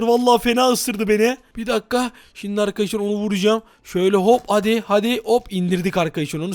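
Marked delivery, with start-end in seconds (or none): none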